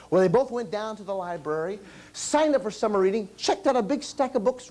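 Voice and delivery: usual speaking voice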